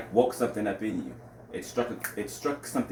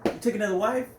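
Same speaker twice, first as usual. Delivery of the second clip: much higher, disbelieving "took"; incredulous; 10 semitones higher, 3 dB louder than usual. Real, not acted. Reacting to the husband's betrayal, incredulous. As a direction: throw the words back in shock. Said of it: "excuse my language".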